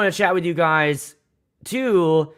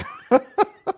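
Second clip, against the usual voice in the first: laughing. Real, not acted. Laughs at his own story.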